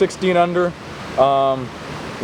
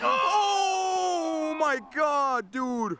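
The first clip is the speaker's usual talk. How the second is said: high, stretched, gasping disbelief; shocked gasp; 10 semitones higher, 9 dB quieter, much slower than usual. Real, not acted. Putt spits out of basket.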